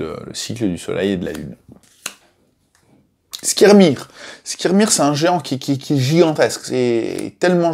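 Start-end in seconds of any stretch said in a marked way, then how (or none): 3.4-4.1 name called out high and loud, falling; title call. Shown as a demonstration. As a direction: announce the name like a headline. Annonce le nom du personnage. (nom annoncé haut et fort)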